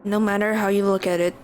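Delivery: usual speaking voice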